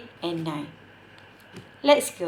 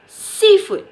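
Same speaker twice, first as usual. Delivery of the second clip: SEA in "Seafood" much higher and louder; stress on SEA; 11 semitones higher, 8 dB louder than usual. Shown as a demonstration. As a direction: punch the first part of "Seafood", then fall away. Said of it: "dekat sea tu kita kena tekankan sikit".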